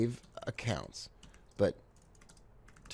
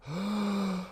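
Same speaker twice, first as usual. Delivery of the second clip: mock gasp; 11 semitones higher than usual. Mock shock at failed trade.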